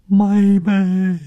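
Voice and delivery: high, loud drawn-out cry; grief-stricken wail. A narrator's reading. Sister crying behind the door for her sister, anguished wail. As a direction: wail it out, heartbroken. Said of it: "一边悲痛欲绝地叫".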